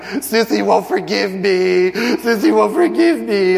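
put-on high, slow whining child; a small boy whimpering that his sister won't forgive him; whiny little boy. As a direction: play the son complaining to dad. Son runs back whining.

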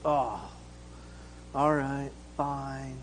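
low, soft, resigned sigh of words; reluctant giving in. Acted. Daughter gives in to dad about karate, reluctant.

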